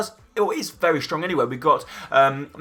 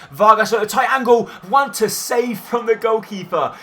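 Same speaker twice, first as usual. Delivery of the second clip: higher and louder shouted call; excited shout; 6 semitones higher, 4 dB louder than usual. Acted. Commentator shouting as the winger shoots, excited. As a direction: yell it as the shot goes in. Shot from tight angle.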